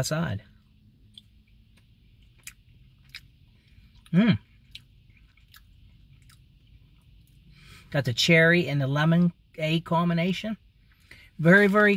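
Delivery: talking with candy in mouth; chewing while talking. Real, not acted. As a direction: mouth partly full. Seen 0: Gummy goes in at -4; jaw and lips keep chewing.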